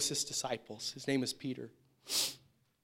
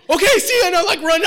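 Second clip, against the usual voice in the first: very high, loud farewell; elated shout; 16 dB louder, faster than usual. Retold or imitated. Kid running out to drive the Jaguar, elated shout. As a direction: fling it over your shoulder and dash. Runs out with keys.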